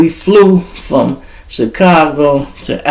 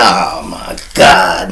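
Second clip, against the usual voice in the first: dread; 8 semitones lower, much slower than usual. Retold or imitated. Dread at going to jail.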